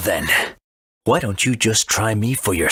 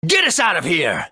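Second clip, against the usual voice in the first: panicked order; 9 semitones higher than usual. Acted. Gaspard orders escape from the sinking ship, panicked shout. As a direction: bark it as the ship sinks.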